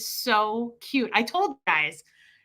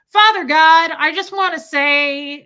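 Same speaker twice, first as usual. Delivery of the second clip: put-on loud, higher prayer of a hard-of-hearing old man; Fred; 5 semitones higher, 10 dB louder than usual. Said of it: "he was so loud because he couldn't hear".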